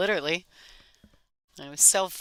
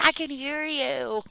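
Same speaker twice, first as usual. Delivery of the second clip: high mock golf announcer; hammed-up hushed commentator; golf commentator whisper; 10 semitones higher than usual. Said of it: "he's trying to use his golf voice".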